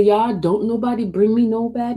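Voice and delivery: ordinary delivery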